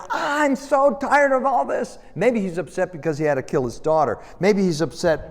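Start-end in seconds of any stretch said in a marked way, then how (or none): 0.1-2.0 high, drawn-out mock exasperation; fed-up outburst. Jephthah attacks those who insulted him, exasperated outburst. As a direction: act out being utterly sick of it.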